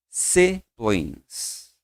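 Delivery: siblings said slowly, SIB-lings falling; model pronunciation. Shown as a demonstration. Teacher models siblings for students to repeat. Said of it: "repete comigo".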